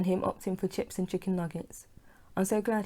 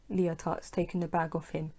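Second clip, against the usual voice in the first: plain reading voice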